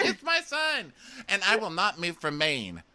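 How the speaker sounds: high-pitched mock outburst; hammed-up high parody mom; exasperated mom. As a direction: squeal it as an indignant mom. Voicing the mom's outburst.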